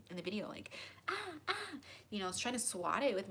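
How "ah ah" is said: short, high re-enacted shrieks; imitated scream. Woman imitating herself screaming at a bee, high mock screams. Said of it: "You could hear me screaming".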